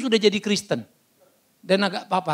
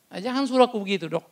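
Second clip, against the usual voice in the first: usual speaking voice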